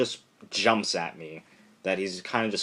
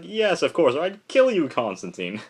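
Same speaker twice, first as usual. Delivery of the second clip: mock cheery threat; 7 semitones higher, 4 dB louder than usual. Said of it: "And he's like".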